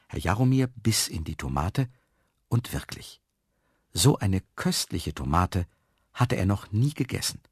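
plain reading voice

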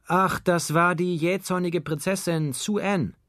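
high, sustained call; calling back. A narrator's reading. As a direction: call the excuse back across the flat. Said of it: "rief er". (hoch und laut zurückgerufen)

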